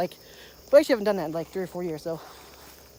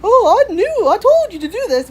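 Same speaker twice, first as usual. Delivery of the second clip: high, loud mock of his smug voice; mocking dad; 12 semitones higher, 9 dB louder, slower than usual. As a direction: squeak it out like a know-it-all dad. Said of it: "he'll be like".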